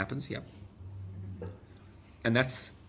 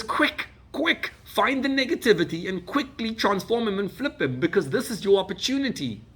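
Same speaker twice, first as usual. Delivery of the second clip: urgent, excited; 10 semitones higher, 4 dB louder than usual. Retold or imitated. Urgent quoted command.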